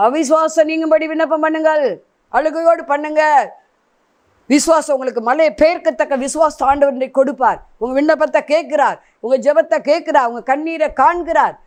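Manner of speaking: high, ringing exhortation; fervent preaching. Real, not acted. Preacher urging listeners to pray away unbelief, fervent preaching.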